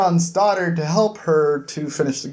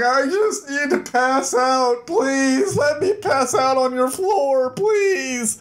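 slow, higher, worn-out pleading; exhausted begging; 8 semitones higher, slower than usual. Retold or imitated. Jazz begging Sabota for his floor, exhausted and slow. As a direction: drag the words out like you're falling asleep.

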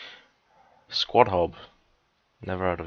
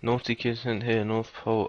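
ordinary delivery

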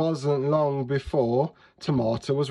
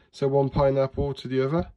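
ordinary delivery

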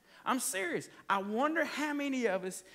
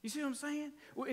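ordinary talk

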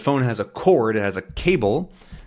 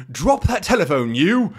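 put-on British accent; 8 semitones higher than usual. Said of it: "he has a British accent".

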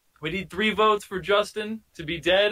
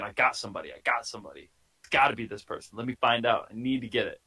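lower, a touch of a scheming inner voice; cop's inner thoughts; 7 semitones lower, 4 dB quieter, faster than usual. Pretend. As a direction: voice the cop's eager hunch, low and quiet. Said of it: "You're thinking".

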